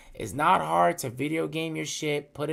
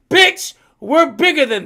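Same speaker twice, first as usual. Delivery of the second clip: high, loud mock-scornful comeback; retold loud retort; 11 semitones higher, 13 dB louder than usual. Imitating a friend dismissing the panic, loud scornful retort.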